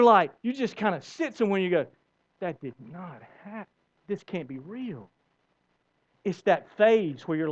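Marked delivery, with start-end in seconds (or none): 2.4-5.1 quiet disbelief. Retold or imitated. Denial voiced in disbelief.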